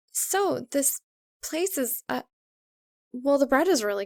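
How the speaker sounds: a hint of nervous girl fumbling for words; Maka, awkwardly making small talk; awkward offer. A narrator's reading. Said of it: "she offers".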